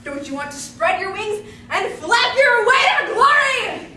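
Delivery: slow, loud, soaring appeal; hammed-up rousing oratory; grandiose exhortation. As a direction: swell into a ringing climax. Schemer's overblown urging.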